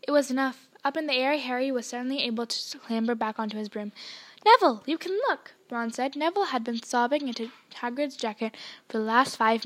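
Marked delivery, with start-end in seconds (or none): 4.5-5.5 eager, raised voice calling out; excited call. Acted. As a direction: call out to him, high and loud. Ron calls to sobbing Neville.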